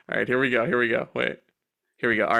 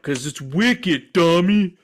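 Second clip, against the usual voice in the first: snooty staff; 3 semitones higher, 4 dB louder, much slower than usual. Retold or imitated. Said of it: "And they're like".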